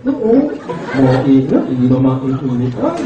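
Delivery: hammed-up nasal crying; mock crying. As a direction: whine like a crying child. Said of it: "Umiyak naman".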